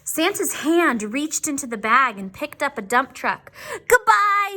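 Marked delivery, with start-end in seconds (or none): 3.9-4.6 high, loud farewell call; shouted goodbye. Acted. Dump truck calling goodbye to the toys, loud. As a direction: call it out as you leave. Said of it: "shouted the dump truck".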